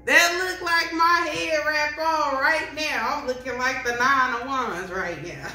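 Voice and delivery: cracking up while talking; laughing. Real, not acted. Reader joking about her own head wrap, laughing.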